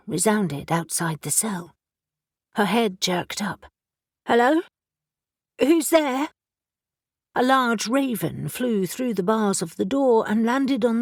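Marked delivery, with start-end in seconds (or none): 4.3-6.3 startled call. Acted. Elle startled in dark cell.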